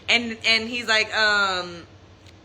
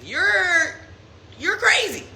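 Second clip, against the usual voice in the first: pitched-up disbelief at his lies; higher, exasperated retold confrontation; retold outrage; 7 semitones higher than usual. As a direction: tell him he's crazy, high and incredulous. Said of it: "And I was like".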